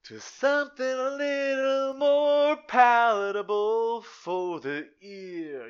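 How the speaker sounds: loud, high, slow and theatrical; high put-on voice. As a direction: belt it out like a show-off. Mock fancy voice for the ear.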